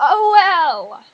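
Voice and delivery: big stretched-out brush-off; loud oh well. Real, not acted. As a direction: belt out a long, careless oh well. Brushing off showing friend's pictures.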